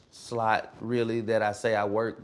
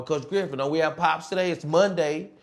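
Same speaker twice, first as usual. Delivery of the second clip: higher, louder mimicked student plea; imitated eager pupil; 7 semitones higher, 5 dB louder than usual. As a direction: lightly play an eager kid pestering the coach. Pupils pestering coach in hallway.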